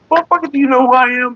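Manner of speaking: high hammed-up indignant voice; mock indignant outrage. Comedian put down by a random guy, hammed-up high outrage. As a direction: play the offended rich star, voice up high. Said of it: "But it's like".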